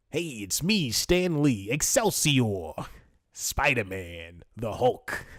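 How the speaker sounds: drawn-out, showman-like impression of a comics legend; Stan Lee. Podcaster imitates a comics creator's catchphrases, put-on showman voice. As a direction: stretch out the catchphrase and hero names in a put-on voice. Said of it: "here's my Stan Lee impression".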